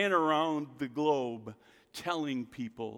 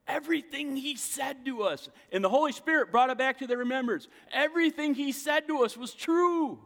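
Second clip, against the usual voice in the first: excited realization; 9 semitones higher, 5 dB louder than usual. Acted. Disciples' excited realization voiced.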